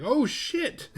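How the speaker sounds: high-pitched startled cry; mock alarm. Family watching Laura jump out, mock alarm. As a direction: blurt it in exaggerated shock. Retold alarm as she bails.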